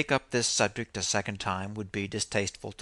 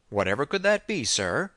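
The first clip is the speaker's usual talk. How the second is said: higher, louder mock curiosity; swooping, sarcastic question; 5 semitones higher, 4 dB louder than usual. A narrator's reading. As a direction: sarcastically feign great interest. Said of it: "he replied sarcastically".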